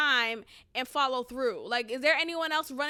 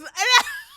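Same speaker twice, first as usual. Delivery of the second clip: sudden high loud laugh; high burst of laughter; 8 semitones higher, 10 dB louder than usual. Real, not acted. Laughing in disbelief at the all-Indian classroom. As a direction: crack up out loud.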